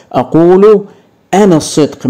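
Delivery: leaning hard on أقول to reveal the pronoun; stressed teaching take. Shown as a demonstration. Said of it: "تقديره".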